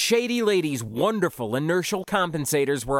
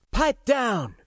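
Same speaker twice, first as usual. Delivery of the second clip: high, sharp order to silence the crew; commanding captain; 9 semitones higher than usual. A narrator's reading. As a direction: cut them all off firmly. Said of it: "Mavis cut us off".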